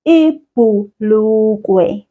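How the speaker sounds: Ibhulukwe said very slowly and clearly; drawn-out teacher model of Ibhulukwe; slow model word. Shown as a demonstration. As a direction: model Ibhulukwe for the child to repeat. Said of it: "repeat that after me".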